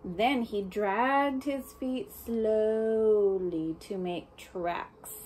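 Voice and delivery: very slow, drawn-out storybook reading; slow dragging read. Acted. Reading to a child, slowly drawn out. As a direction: stretch it out like heavy dragging feet.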